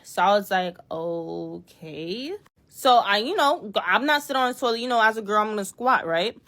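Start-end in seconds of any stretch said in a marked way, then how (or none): 0.9-2.3 dubious okay. Retold or imitated. Resigned okay at newspaper doorknob.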